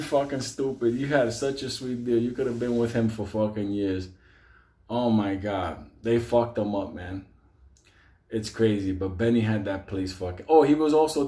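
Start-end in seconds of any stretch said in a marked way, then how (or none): none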